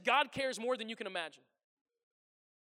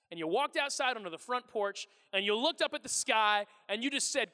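ordinary talk, nothing marked

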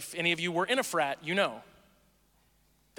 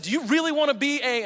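raised, higher challenging question; mock frat interrogation; 6 semitones higher, 4 dB louder than usual. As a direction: grill the pledge like a senior. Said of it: "And I just said".

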